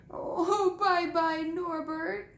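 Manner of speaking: high, sobbing farewell; hammed-up tearful goodbye, voice pitched high; mock sobbing. A narrator's reading. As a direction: whimper goodbye like a heartbroken giant. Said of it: "Hagrid sobbed".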